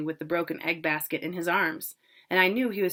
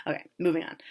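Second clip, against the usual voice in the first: ordinary delivery, nothing marked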